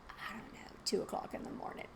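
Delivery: hushed, breathless aside; whispered aside. Real, not acted. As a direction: whisper it like a secret at night. Setting up a night scene.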